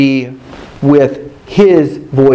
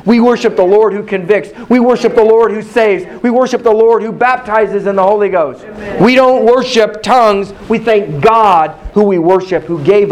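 higher, repeated building cadence; rhythmic preaching; 7 semitones higher than usual. Acted. Preacher driving home whom the church worships. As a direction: hammer each line like a drumbeat.

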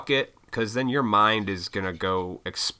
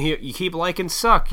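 ordinary talk, nothing marked

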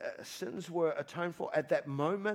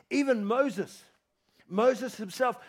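ordinary talk, nothing marked

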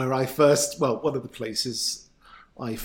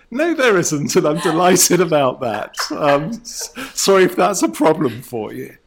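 defiant retort; 5 semitones higher, 9 dB louder than usual. Retold or imitated. Acts out defiant reply to jibe.

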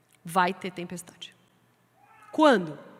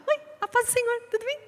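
mock churchy greeting, pitched much higher; a sugary higher "Oi, a paz do Senhor"; mock sweet churchgoer; 13 semitones higher, faster than usual. Teasing church members who act nice, with a sugary churchy greeting. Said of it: "Fala mansinho, fala baixinho".

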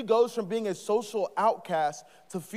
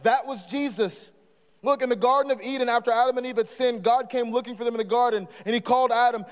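ordinary talk

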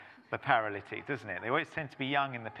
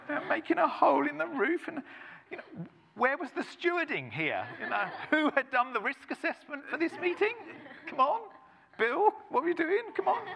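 mock exasperation; 14 semitones higher than usual. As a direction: squeal it like a fussy safety officer.

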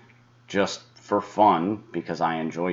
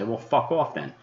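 retold retort; 5 semitones higher than usual. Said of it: "and then I'll say".

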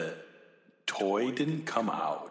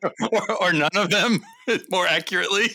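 laughing; 11 semitones higher, 9 dB louder than usual. Real, not acted. Joking that all episodes are bad.

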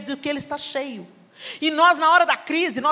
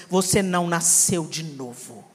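lower, slow solemn mother; stern authority; 8 semitones lower, slower than usual. Retold or imitated. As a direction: pronounce a firm verdict. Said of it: "coberta da autoridade do Senhor".

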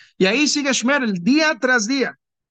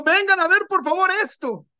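higher, eager summoning of the angels; proud father showing off his son, higher; excited calling; 7 semitones higher than usual. Acted. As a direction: call everyone over to see, thrilled. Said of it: "Llamo a todos los malachim y les digo".

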